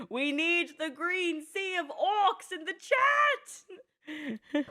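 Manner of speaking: high, very slow laughing wail; drawn-out laughing cry; laughing plea. Real, not acted. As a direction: beg chat while cracking up.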